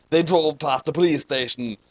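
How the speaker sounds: usual speaking voice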